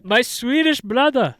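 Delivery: high, loud mock Swedish accent; hammed-up Swedish brother voice; terrible put-on Swedish accent; bad Swedish accent. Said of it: "That was a terrible accent".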